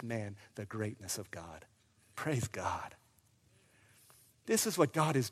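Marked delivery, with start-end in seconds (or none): 0.0-2.9 low, soft praise; hushed reverence. Real, not acted. Preacher praising God after the family believes, hushed. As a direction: drop to a quiet, reverent close.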